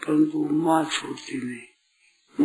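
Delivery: usual speaking voice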